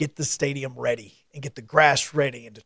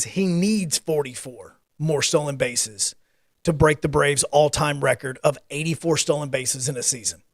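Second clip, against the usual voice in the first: ordinary talk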